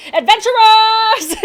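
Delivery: very high, loud, drawn-out call; gnome shout. Acted. Gnome calling the lab to attention, loud and high. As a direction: announce it to the whole room.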